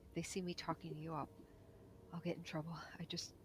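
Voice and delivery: small frightened whisper from under rubble; buried underground. Acted. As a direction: whisper it, scared and hidden. Same frightened buried figure.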